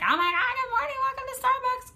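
super happy chipper. Retold or imitated. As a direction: gush like the peppiest barista alive. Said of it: "super happy chipper".

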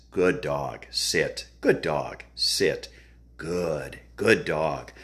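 low-pitched commands and praise; dog-training drill. Shown as a demonstration. Trainer drilling sit then praising the dog, low measured voice.

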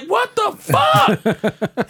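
high, drawn-out yell of disbelief; exasperated shout. Retold or imitated. Driver pulled over again, drawn-out exasperated shout. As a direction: stretch it into a high, loud wail. Acted reaction to being stopped again.

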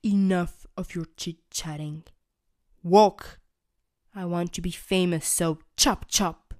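loud, low giant barking orders; shouting giant. Acted. Big Trevor ordering the toothbrushes to walk. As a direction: bark at them to hurry. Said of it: "shouts the big Trevor".

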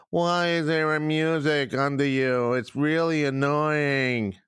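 drawn-out whiny listener imitation; mock whining. Host replaying listeners' gripe about the music, mock whining. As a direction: mimic a complainer, stretching out the whine.

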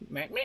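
small squeaky honk; soft, comic squeak noise; mock nose squeak. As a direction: imitate squeezing a squeaky nose. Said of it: "Squeak his nose".

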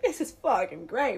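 hammed-up excitement; mock sixties enthusiasm. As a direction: cheer a new invention, tongue in cheek. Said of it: "in the sixties we're like".

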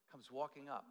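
ordinary talk

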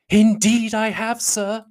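high, drawn-out emphatic reply; high-pitched, slow matronly voice; fussy mother. A narrator's reading. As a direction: play the self-important mother. Mrs Bennet answers Bingley.

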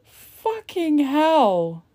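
very slow, loud Fucking hell; slow exasperated curse; drawn-out swear. Real, not acted. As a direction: stretch the swear in disbelief. Stretched exclamation.

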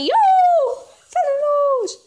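very high, loud holler; calling out. Acted. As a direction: shout across the street to him. Calling out to neighbour.

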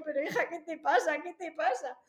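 high-pitched alarmed questioning; acted maternal alarm. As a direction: replay a worried mother pressing her child. Madre asustada ante su hija llorando. (madre alarmada, imitada con tono agudo)

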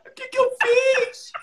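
high, drawn-out wail; hammed-up high sobbing voice; mock crying. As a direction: imitate a tearful woman wailing in exaggerated distress. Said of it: "A mulher começa a chorar".

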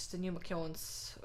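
unvoiced whisper aside. Real, not acted. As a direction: whisper it to yourself. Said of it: "whispered aside".

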